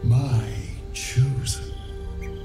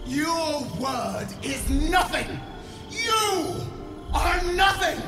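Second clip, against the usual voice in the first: raging, raised voice; furious shout; 4 semitones higher than usual. Acted. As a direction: roar it with contempt. Queen rages at defiant servant.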